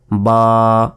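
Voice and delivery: বা held long on a level note; drawn out. Shown as a demonstration. Teacher showing learners how to read the letter, drawn out. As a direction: stretch the vowel of বা. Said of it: "এভাবে টেনে পড়বেন".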